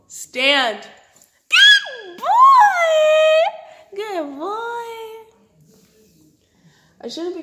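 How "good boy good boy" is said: very high, loud squeal of praise; squeaky praising a dog. Real, not acted. Praising dog after trick.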